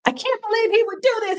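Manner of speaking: imitating angry wife. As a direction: play her outraged disbelief, lightly. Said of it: "and she was angry".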